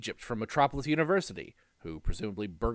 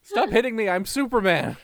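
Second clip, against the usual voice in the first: higher, louder pleading hero; whiny beaten Superman; 9 semitones higher, 6 dB louder than usual. Pretend. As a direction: beg to stop like a beaten hero.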